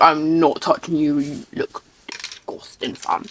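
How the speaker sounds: low roadman voice for the cat; fake tough gangster. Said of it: "Stitch is a roadman at heart".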